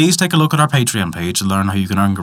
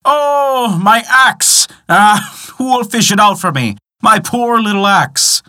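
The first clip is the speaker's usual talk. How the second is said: crying out; 10 semitones higher, slower than usual. A narrator's reading. Poor man cries out over his lost axe, high wail. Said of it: "The poor man cried out".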